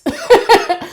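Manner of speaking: a burst of high bright laughter; real laugh. Real, not acted. Laughing at her own story of crying. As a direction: laugh out loud at yourself.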